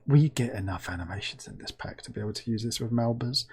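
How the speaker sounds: ordinary talk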